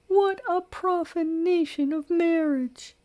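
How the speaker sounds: high, scandalised matron crying out; shocked exclamation. Acted. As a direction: gasp it out, outraged and prim. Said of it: "she exclaimed".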